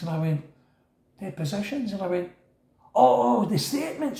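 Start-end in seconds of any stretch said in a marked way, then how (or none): 1.2-1.9 softer, higher questioning echo; puzzled echo. Retold or imitated. New prisoner asked for court papers, quietly baffled. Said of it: "I didn't know they were called that". 2.9-4.2 high-pitched dawning "oh"; sudden realisation. Retold or imitated. Prisoner grasping what depositions are, high-pitched realisation.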